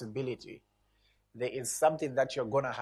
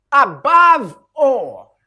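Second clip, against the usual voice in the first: above all drawn out very slow, much higher and louder; emphasis on above all; 10 semitones higher, 16 dB louder, much slower than usual. Shown as a demonstration. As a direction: stretch out "Above all" and belt it. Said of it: "There is an emphasis".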